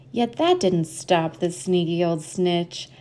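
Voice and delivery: usual speaking voice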